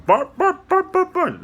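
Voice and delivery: high yappy little barks; playful high yips; puppy barks. Retold or imitated. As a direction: bark like a small puppy. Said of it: "Or a barking".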